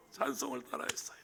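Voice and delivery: breathless, whispered through tears; choked whisper. Retold or imitated. Deacon tearfully telling pastor the child sang, whispered. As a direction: let the voice vanish into a whisper. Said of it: "목이 메어서 울면서, 울먹이면서".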